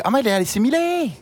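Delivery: high-pitched mock Besançon accent. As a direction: exclaim it like a Besançon local. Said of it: "si t'es assimilée et que t'es bisontine, tu dis".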